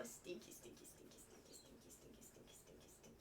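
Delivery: soft unvoiced cooing chant; whispered pet name. Real, not acted. Owner nuzzling her dog, whispering his nickname.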